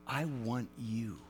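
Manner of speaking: soft, low and slow, almost hushed; tender voice of God. Retold or imitated. God speaking to Paul, soft and tender. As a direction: say it like a quiet promise.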